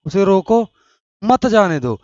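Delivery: loud "उसे रोको", a break, then "मत जाने दो"; pause after रोको. Shown as a demonstration. Teacher shows students the comma, pausing after रोको in a loud order. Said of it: "pause लिया थोड़ा".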